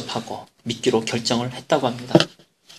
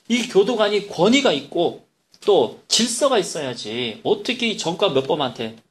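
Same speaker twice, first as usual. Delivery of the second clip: ordinary talk, nothing marked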